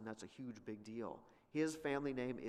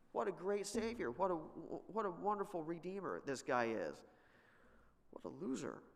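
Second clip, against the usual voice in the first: higher, mock-admiring acclaim; sarcastic praise; 6 semitones higher than usual. Real, not acted. Preacher mocks Jephthah for the civil war, sarcastic. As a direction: gush in irony, then drop to scorn.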